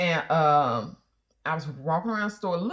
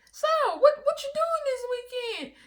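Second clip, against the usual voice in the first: much higher, coy question; flirty teller. Retold or imitated. Wife mimics teller asking husband about weekend, much higher. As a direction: fish for his weekend plans.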